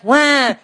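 high mock baby wail; baby cry imitation. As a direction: cry "Wah" like a newborn, playfully. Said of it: "And they're like".

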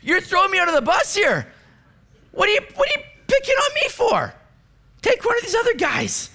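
high-pitched, protesting to God; put-upon, incredulous high whine; indignant Job. Pretend. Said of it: "can you imagine Job if he heard this?".

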